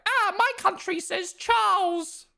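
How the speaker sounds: very high, drawn-out mocking voice; mock objector. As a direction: squeal slowly like a silly complainer, Charles as CHARLZ. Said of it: "they'll be like".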